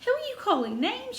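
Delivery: high indignant retort. A narrator's reading. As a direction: bristle back at the insult. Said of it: "Martha stared and looked hot".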